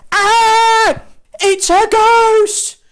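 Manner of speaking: high, loud, slow comic scream; hammed-up terrified scream. A narrator's reading. Disciples see a figure on water, comic terrified scream. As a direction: shriek it like panicking disciples. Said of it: "screamed in terror".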